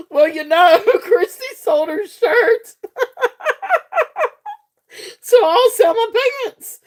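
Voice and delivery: high voice breaking up with laughter; real laughing fit. Real, not acted. Seller joking with viewers about selling her pants, cracking up. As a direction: squeal the words out through helpless giggles. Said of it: "I'm gonna be laughing about this day".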